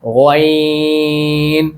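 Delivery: गॉइन stretched very slow and gentle; polite ghain. Shown as a demonstration. Teacher modelling a polite गॉइन for children, slow. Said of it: "बगैर सोचे सीधा सीधा क्या पढ़ेंगे".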